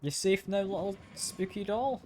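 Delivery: high, slow cooing; tender baby talk. Acted. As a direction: coo gently to a little doll. Cooing to the rescued doll.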